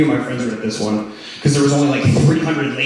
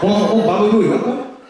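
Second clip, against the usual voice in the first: mock sidekick; 6 semitones higher, 3 dB louder than usual. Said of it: "sidekick reacts".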